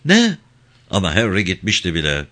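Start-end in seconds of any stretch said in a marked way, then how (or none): 0.1-0.4 very high-pitched, startled query; a touch of intimidated confusion; bewildered clerk. A narrator's reading. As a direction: squeak it out, baffled and cowed.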